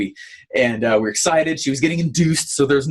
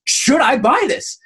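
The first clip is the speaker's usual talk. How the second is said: mild mock frustration; 10 semitones higher than usual. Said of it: "I started getting frustrated".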